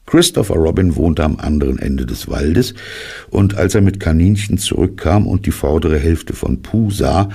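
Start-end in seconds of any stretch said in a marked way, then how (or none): none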